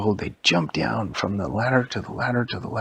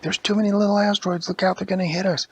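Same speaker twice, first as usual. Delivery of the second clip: high, urgent storybook warning; mock panic; 8 semitones higher, faster than usual. Elvie to partner amid asteroids, mock panic. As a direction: warn your partner in a raised, acted panic.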